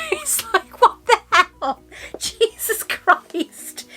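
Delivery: high laughing exasperation; laughing disbelief. Real, not acted. Laughing at her own meltdown, high and exasperated.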